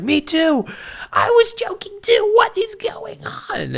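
very high falsetto protest; hammed-up high-pitched confusion; bewildered squeal. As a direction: squeak it out in mock panic. Retells own bewildered outcry.

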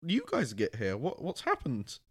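high-pitched, incredulous questions breaking into laughter; mock bewilderment. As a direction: squeak out baffled questions, half laughing. Acted bafflement at ambushing Americans.

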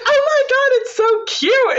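very high squeal with a laugh; squealing girlfriend. Retold or imitated. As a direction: gush over the cabin like a giddy girl. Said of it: "And she's like".

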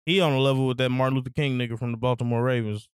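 usual speaking voice

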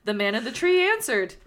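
ordinary delivery, nothing marked

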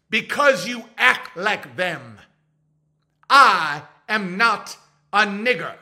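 slow, loud, stiff formal voice; lecturing the friend, slow and stiff; mock stern proper gentleman. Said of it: "I'm like".

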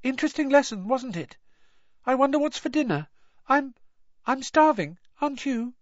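very high, nervous small talk; squeaky, faltering attempt at normal chat. A narrator's reading. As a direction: chatter in an unnaturally high voice. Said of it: "his voice much higher than usual".

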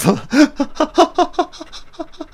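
a big, high burst of laughter. Real, not acted. After a gory kill on screen, a high burst of laughter.

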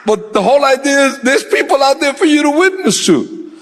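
high, animated preacher's storytelling; energetic sermon telling; lifted preaching. Real, not acted. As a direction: tell it from the pulpit, voice up.

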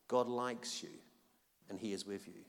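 fading to a soft hush; quiet, tender reassurance; soft blessing. Acted. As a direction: let it drift down gently. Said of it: "Who needs to hear that today?".

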